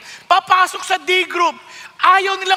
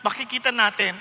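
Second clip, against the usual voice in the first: usual speaking voice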